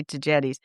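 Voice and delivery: Tagetes with a soft g; "jet" in the middle of Tagetes; preferred soft g. Shown as a demonstration. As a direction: say Tagetes as tuh-JET-eez. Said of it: "I always say Tagetes".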